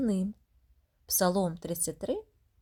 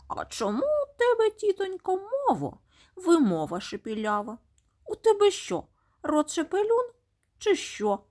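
high, teasing mockery of the aunt; mocking taunt; 10 semitones higher than usual. Nasty word taunting the aunt, high and mocking. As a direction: jeer at her lisp in a high voice. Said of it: "І сміється голосно, насміхається".

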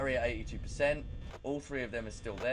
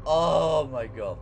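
shaken exclamation; 3 semitones higher, 10 dB louder, slower than usual. Real, not acted. Recovering from scare.

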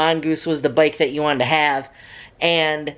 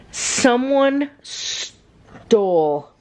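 slow dramatic emphasis; much slower than usual. Real, not acted. Storyteller revealing the bike was stolen, slow dramatic emphasis. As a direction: stretch each word out with long pauses.

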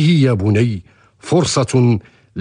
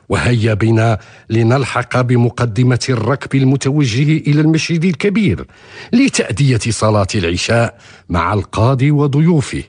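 ordinary delivery

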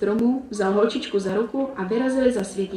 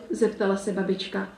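usual speaking voice

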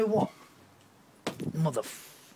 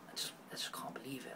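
barely audible whisper of disbelief; much softer, hushed disbelief; stunned whisper; 4 semitones lower, 17 dB quieter than usual. Real, not acted. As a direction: whisper it, lost for words. Stunned by the crime.

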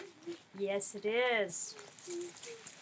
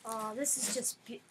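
usual speaking voice

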